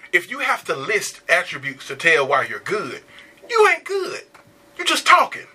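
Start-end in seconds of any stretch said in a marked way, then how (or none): 3.5-5.5 raised, pointed voice; high emphatic. Real, not acted. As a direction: say it higher, with bite. Emphatic rising rebuke.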